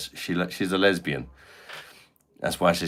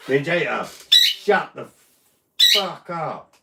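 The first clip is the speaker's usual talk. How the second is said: furious yell across the room at the parrot; annoyed yell at the parrot; 8 semitones higher, slower than usual. Real, not acted. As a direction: shout the bird down, fed up. Yelling at the noisy parrot.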